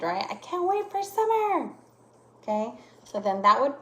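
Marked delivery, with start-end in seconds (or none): 0.3-1.7 high-pitched excitement at normal volume; excited exclamation. Shown as a demonstration. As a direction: lift the pitch well up, excitedly. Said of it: "kinda like I'm excited".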